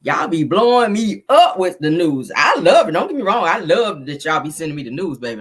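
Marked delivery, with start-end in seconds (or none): none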